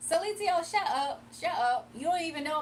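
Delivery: loud playful shut up, pitch jumping up; mock shut up. Real, not acted. Streamer playfully telling a teasing viewer off, loud and mock-stern. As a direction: loudly tease the viewer to shut up.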